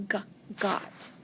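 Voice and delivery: g, got breathed softly, nearly unvoiced; soft sounding out. Shown as a demonstration. Teacher sounding out got for the class. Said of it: "let's spell got".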